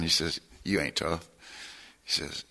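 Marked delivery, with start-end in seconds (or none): 0.6-1.3 low, soft retold rebuke; quiet put-down. Old gangster to cocky newcomer, quiet low put-down. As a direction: imitate an old-timer's quietly certain put-down.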